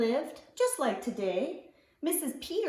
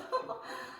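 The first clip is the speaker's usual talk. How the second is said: soft giggle; 12 semitones higher, 8 dB quieter than usual. Acted. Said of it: "giggle softly".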